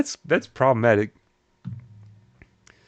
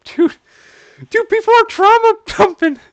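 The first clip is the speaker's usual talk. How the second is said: high, loud, shocked shout; stunned outcry; 7 dB louder than usual. Real, not acted. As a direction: yell it in disbelief. Outcry at trauma dumping.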